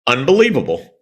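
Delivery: ordinary delivery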